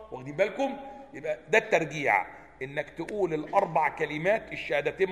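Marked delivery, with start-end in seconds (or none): none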